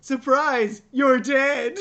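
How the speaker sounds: spring it on him like a punchline; gleeful mock surprise. Friend echoing the movie's villain line, gleefully mocking. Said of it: "repeating her line".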